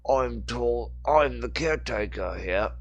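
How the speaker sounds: put-on slow thick Cockney old man; slow Cockney, knowing and sly; Cockney accent. A narrator's reading. Dalish character voice.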